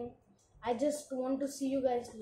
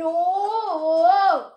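loud startled yelp; a sudden loud gasp of shock; startled shout; 6 semitones higher, 13 dB louder than usual. Real, not acted. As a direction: jump at something on screen. Sudden game event.

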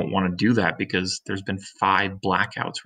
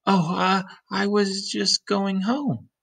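put-on higher, slow, caught-out girl; flustered Lizzie; 8 semitones higher, slower than usual. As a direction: stall nervously like she's hiding something.

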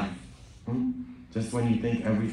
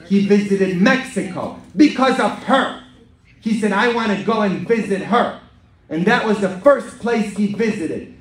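raised, forceful pulpit voice; emphatic preaching; 8 semitones higher, 7 dB louder than usual. Acted. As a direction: drive the point home to the congregation.

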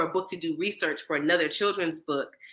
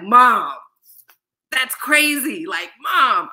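loud, higher shout of disbelief; son shouting; 5 semitones higher, 8 dB louder, much slower than usual. Retold or imitated. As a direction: yell "Mom" like a stunned teenager.

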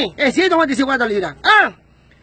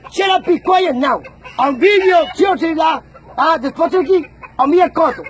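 switch to English; slower than usual. Real, not acted. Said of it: "se lo voy en inglés".

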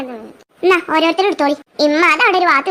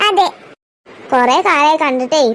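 ordinary delivery

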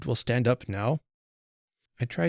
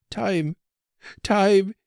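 higher, slow, louder desperate plea; pleading cry; 10 semitones higher, 6 dB louder than usual. A narrator's reading. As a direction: cry out, reaching out his arms. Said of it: "he cried".

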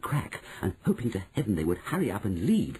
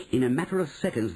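plain reading voice

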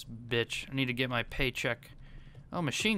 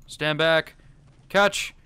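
ordinary talk

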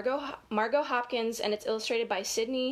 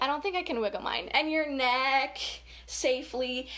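ordinary delivery

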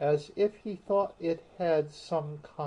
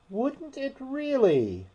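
much higher amazed question; great surprise; 13 semitones higher than usual. A narrator's reading. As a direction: gasp it out, amazed. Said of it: "in a tone of great surprise".

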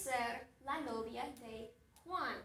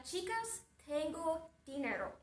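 ordinary talk

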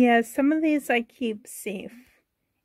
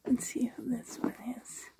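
whisper; 10 dB quieter than usual. Real, not acted. Uncovering a hidden doll.

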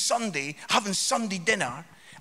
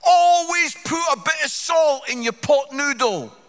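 louder, higher mock shout; lightly done loud heckle, louder and higher; heckler shouting; 6 semitones higher, 7 dB louder than usual. Random heckler yelling his pot noodle tip. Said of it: "he just shouts out this".

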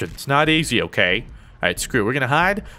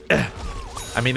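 usual speaking voice

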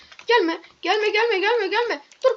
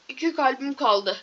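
normal talking voice

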